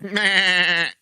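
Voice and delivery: a hint of the Family Guy dad; lightly voiced cartoon laugh; Peter Griffin voice. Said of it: "why are we doing Family Guy".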